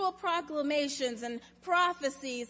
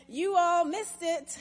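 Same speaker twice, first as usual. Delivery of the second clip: put-on proud child's announcement, higher and louder; raised, triumphant proclamation; 4 semitones higher, 5 dB louder than usual. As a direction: declare it like a kid who knows better. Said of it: "stood up proudly and said".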